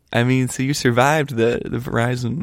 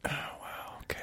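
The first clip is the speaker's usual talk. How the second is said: whispered mock wow; 22 dB quieter than usual. Real, not acted. Mock amazement at second story.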